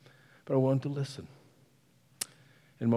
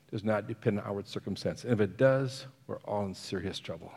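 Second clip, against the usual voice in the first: ordinary talk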